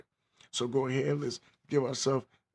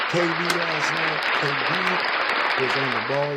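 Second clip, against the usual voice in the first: hype shout-out; 3 semitones higher, 8 dB louder than usual. Real, not acted. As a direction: call the name out big like a hype man. Shouting out player's big game.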